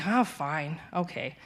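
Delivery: reluctant. Retold or imitated. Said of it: "So I'm like".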